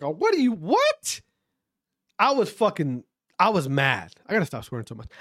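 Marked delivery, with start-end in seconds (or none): 0.0-1.2 shocked disbelief. Retold or imitated. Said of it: "I seen that and I was like".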